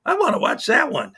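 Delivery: can't wait to hit play; excited. Real, not acted. Streamer eager to watch the shotgun video, excited.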